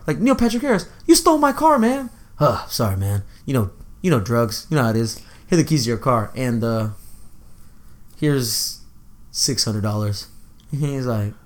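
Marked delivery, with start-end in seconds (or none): 0.2-2.1 much higher, whiny complaining voice; high, indignant mock complaint; mock outraged friend. Quoting the movie character. 2.4-10.3 a hint of a low, softer smug voice; laid-back Neil Patrick Harris. Quoting Neil Patrick Harris character.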